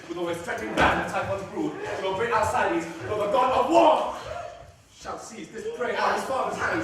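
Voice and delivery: Aaron swearing none shall take his son; defiant vow. Acted. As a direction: swell into a defiant boast. Aaron vows to defend child.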